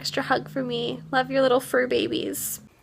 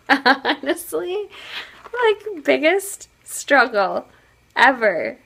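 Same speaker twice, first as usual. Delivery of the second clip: laughing; 5 semitones higher, 5 dB louder, slower than usual. Real, not acted. Laughing at a kitchen mishap.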